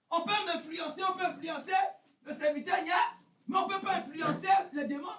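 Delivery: fiery sermon shout; preaching at full force. Acted. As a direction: drive the message home to the congregation.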